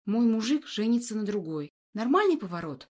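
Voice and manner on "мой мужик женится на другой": ordinary delivery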